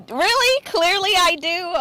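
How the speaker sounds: high, loud really followed by a slow clearly I do; indignant disbelief. Real, not acted. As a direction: play mock offence at the jab. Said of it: "clearly, you do, though".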